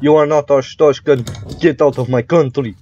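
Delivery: hammed-up Russian border guard accent; terrible Russian accent. Inspector turning away a foreigner, terrible Russian accent. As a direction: dismiss them in a badly faked Russian accent. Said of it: "I love doing this accent".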